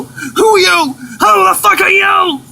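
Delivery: high-pitched panicked shout; alarmed demand. Acted. Captive confronts unknown figure.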